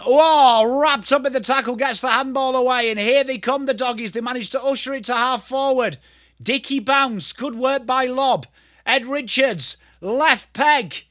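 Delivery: high, excited footy commentary; live play-by-play. Real, not acted. Commentator calling the doggies going forward, excited and high.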